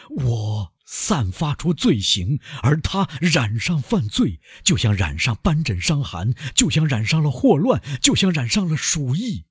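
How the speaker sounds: high-pitched, agitated outburst of self-accusation; frenzied anguish. A narrator's reading. Villefort raves at himself in the carriage, frenzied and anguished. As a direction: pile up the comparisons with mounting torment. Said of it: "癫狂的叫喊".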